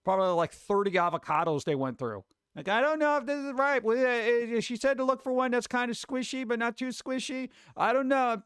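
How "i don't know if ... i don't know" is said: put-on unsure kid voice. Imitating unsure kids with avocados.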